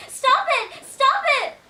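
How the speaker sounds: high, very loud panicked yell. Acted. Two sisters yelling at Julia as she floors it, panicked shout. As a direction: yell it full force at the driver. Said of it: "Zoe and Katie are yelling".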